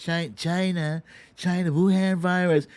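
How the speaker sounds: lightly voiced Trump-style sneer on China; mock Trump voice. Mocking the president blaming China, a light put-on voice. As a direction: toss off China like a blustering politician.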